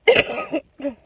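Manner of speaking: sharp real cough from allergies; a sudden loud cough that breaks the story; allergy cough. Real, not acted. Vlogger coughing mid-story from allergies, sharp real cough. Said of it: "Okay, allergies".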